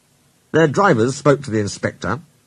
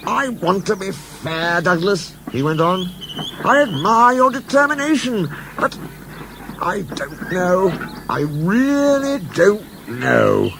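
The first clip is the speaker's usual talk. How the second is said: lightly voiced stout boss; a hint of a kindly gentleman; Fat Controller voice; 8 semitones higher than usual. A narrator's reading.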